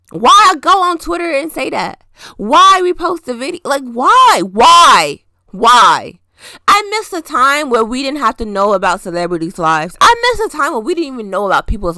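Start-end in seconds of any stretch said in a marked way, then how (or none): 0.1-6.2 exasperated why. Real, not acted. Ranting at celebrities who repost videos.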